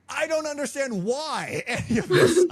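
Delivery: bursts into laughter halfway through the line; can't keep a straight face while ranting; laughing through it. Retold or imitated. Dad ranting to his kid about a book, laughing.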